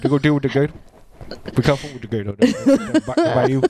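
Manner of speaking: lightly put-on Cajun voice; Cajun accent. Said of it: "Are you doing a Cajun accent now?".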